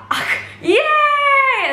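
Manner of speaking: very high, stretched-out squeal; mock fan scream, laughing; imitated crowd cheer.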